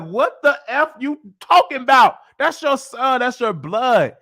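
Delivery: high, loud indignant outburst; loud, high-pitched scolding retort; outraged reaction. Retold or imitated. Retold outraged reaction to film mother.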